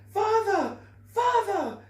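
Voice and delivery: high, loud cries of "Father!"; children calling to their father from the road. Acted. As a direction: call out as a child being taken away. Children call to Henry.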